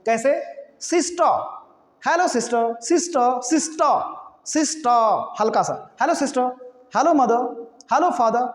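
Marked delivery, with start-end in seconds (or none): 0.8-1.4 English-style "सिस्टर" without r; r dropped. Shown as a demonstration. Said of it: "इस आर को ना बोलिए". 2.8-5.3 English-style "सिस्टर" without r; r dropped. Shown as a demonstration. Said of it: "इस आर को ना बोलिए".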